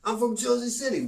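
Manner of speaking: high, loud mock Jersey bro voice; brash hammed-up Jersey City guy; Jersey accent. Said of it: "Love the Jersey talk".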